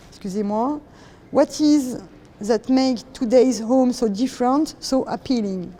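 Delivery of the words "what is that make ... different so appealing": English with a thick French accent; self-mocked "froggy" French accent in English. Real, not acted. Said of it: "Appréciez mon bel accent de grenouille".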